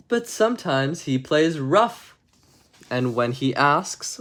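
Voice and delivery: slow, measured bedtime-story reading; story narration. Acted. Streamer reading a bedtime story to chat, storybook narration. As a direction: read it like a storybook to kids.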